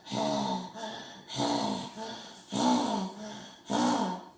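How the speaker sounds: heavy mouth-breathing pant; monster panting. Acted. Monster shows his scary breathing to the kid. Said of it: "Herbert's panting".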